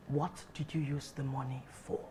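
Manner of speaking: very low, hushed and stern; quiet, ominous question. Acted. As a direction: press for the truth very softly. Mother presses son for truth.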